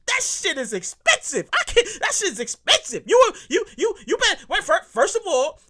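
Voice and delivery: high, stammering comic outrage; worked up, breathless. Real, not acted. Comic ranting about the expense, worked up and breathless. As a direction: trip over the words, running out of breath. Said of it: "I gotta catch my breath here".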